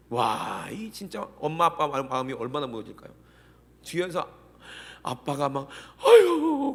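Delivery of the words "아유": high, loud mock wail; wailing sigh. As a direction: imitate a father crying out in grief. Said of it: "울고". (아빠가 우는 소리를 흉내 내며 높고 크게)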